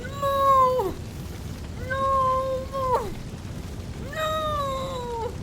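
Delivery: high, desperate muffled screams of no; terrified cries. Acted. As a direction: beg in terror as the poker comes closer. Prisoner facing a hot poker.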